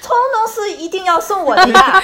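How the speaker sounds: loud, high playful protest; haggling customer. Pretend. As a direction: tease the auntie not to rip you off. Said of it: "说一遍这个对话".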